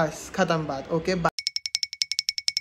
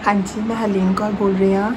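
fake Harleen Kaur. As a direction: play a polite Punjabi woman calling a stranger. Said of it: "ना कि कोई और हरलीन कौर".

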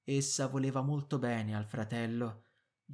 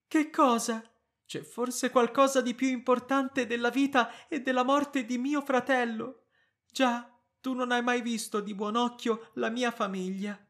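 indignant accusation; 10 semitones higher, 6 dB louder than usual. Acted. Wife angrily accuses husband.

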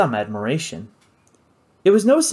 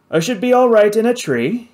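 ordinary delivery, nothing marked

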